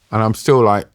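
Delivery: ordinary talk, nothing marked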